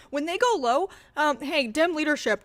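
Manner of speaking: ordinary talk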